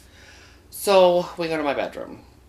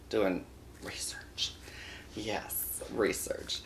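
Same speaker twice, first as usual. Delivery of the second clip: whispered aside; 4 semitones lower, 11 dB quieter, much slower than usual. Real, not acted. Aside about Taylor whispering.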